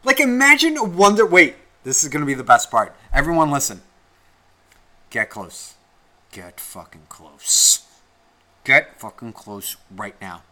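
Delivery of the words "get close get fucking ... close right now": low, slow, drawn-out command; conspiratorial. Pretend. Telling everyone to listen to the best part, conspiratorial.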